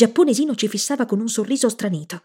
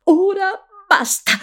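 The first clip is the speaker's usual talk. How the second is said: much higher, louder, slow cry; shout; 11 semitones higher, 4 dB louder, slower than usual. A narrator's reading. Nora shouts as she pulls the boy to his feet, high loud cry. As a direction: cry out to break the spell. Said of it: "gridò Nora".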